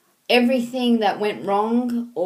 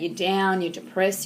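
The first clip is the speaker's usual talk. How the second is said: normal talking voice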